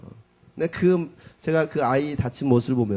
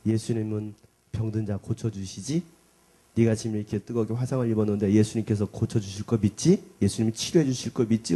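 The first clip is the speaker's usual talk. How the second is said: gentle coaxing; 6 semitones lower than usual. Retold or imitated. Father comforting crying daughter.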